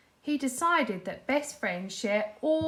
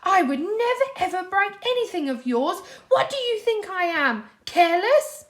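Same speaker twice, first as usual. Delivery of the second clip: high, loud indignant rant; upset rant; 9 semitones higher, 7 dB louder than usual. A narrator's reading. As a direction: throw the words out in outrage. Patrick ranting, upset.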